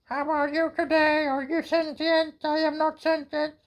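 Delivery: high, squeaky toy robot. Pretend. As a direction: chirp it like a little robot. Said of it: "This looks like a robot".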